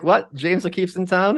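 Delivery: a hint of a startled senator; mock alarm. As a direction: play it as a panicked politician hearing the news. Imagining senator getting the call.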